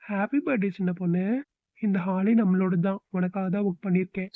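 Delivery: normal talking voice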